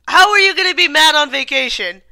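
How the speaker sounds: loud, high mock yell of a song line; playful mock shout; retold yell. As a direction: belt it at the dog. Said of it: "I yelled".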